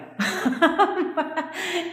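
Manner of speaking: high burst of laughter; real, high giggling fit; amused laugh. Real, not acted. Coach laughs at yelling like a psycho, high laugh.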